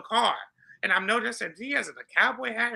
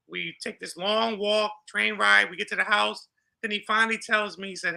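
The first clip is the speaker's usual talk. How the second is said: usual speaking voice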